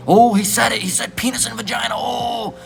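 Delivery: high, shrieking mock outrage; mock shocked gasp. Preacher mocking prudes shocked by the words penis and vagina. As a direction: squeal it like a scandalized prude.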